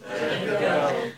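hushed, voiceless whisper; very quiet. A narrator's reading. John calls off his magic hammer, very quietly. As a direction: whisper it very softly. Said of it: "John said very quietly".

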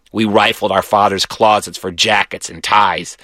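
usual speaking voice

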